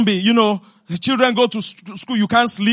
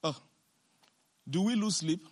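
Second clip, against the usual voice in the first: ordinary talk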